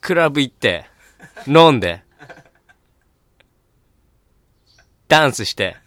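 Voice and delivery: loud party-hype voice; gyaru speech. Acted. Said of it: "ヒップホッパー". (大声でノリノリのパーティー口調)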